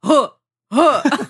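loud huffing exhales; releasing toxins huff; forceful breath grunts. Shown as a demonstration. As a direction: push out loud huh sounds. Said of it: "Do it with me".

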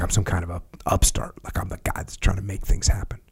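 hushed, breathy whisper, mocking the asker. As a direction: whisper it like a secret grievance, half-joking.

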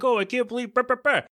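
high-pitched mocking babble; mock freaking out. Mocking people shocked by a TikTok, high freaked-out voice. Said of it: "everybody was freaking out".

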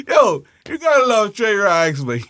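ordinary talk

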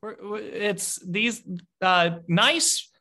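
high, nervous and placating. Acted. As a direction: squeak it out anxiously to calm them. Nervous guard calling to friends above.

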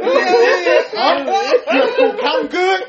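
high, loud mock interrogation; mock angry dad. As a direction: play a dad working up to a whooping. Acts out father interrogating before whooping.

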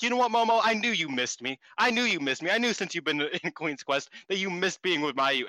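a hint of a smug Mayu; mock Mayu. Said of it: "Mayu starts being like".

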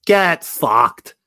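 loud, high mock Aussie; hammed-up Australian accent. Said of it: "It's a terrible Australian accent".

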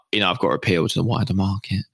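normal talking voice

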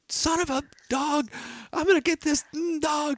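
high, strained angry cursing at a runaway dog; mock furious yell. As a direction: spit the words out like a furious owner chasing the dog.

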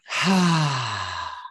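slow exhale through the mouth; long breath out. Real, not acted. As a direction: let the breath go. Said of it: "out through the mouth while tapping".

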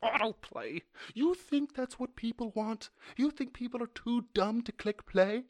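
high, loud indignation; angry rant. Real, not acted. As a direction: berate the website at full pitch. Ranting at website autoplay.

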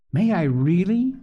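put-on high, hopeful child's question; hopeful little girl. A narrator's reading. Phronsie asking Polly about doing dishes, hopeful little girl. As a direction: ask it like a thrilled small child. Child asks, radiant through tears.

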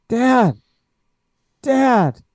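put-on high, loud panicked boy calling Dad; son's frantic call. Boy shaking his dad awake, frantic call. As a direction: shout to wake your father. GM voices son waking father.

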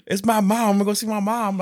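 put-on high childish whine; high little-kid insistence. Uncle imitating the niece insisting on seeing her mom, high.

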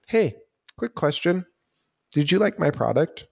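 high-pitched, polite customer check-in; friendly seller. Acted. Seller checking in with a customer, friendly and high. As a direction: pitch it up for a quick, friendly ask.

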